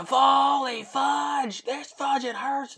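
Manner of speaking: high, loud, drawn-out fake swearing in agony; mock pained screaming. Patient in agony with a kid in the room, hammed-up scream. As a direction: scream in pain, censoring yourself with silly words. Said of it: "I went from screaming".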